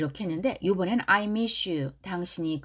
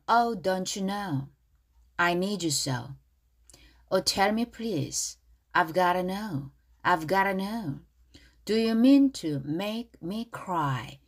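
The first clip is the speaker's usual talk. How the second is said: slow, careful English for repeating; pronunciation model; 3 semitones lower, much slower than usual. Shown as a demonstration. Teacher going over the chorus pronunciation again, slow careful model reading. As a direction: model each phrase slowly with linked words. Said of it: "발음 다시 한번".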